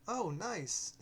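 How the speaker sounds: high, loud delighted cry; light up at the find; excited exclamation. Acted. Oliver finds village, excited.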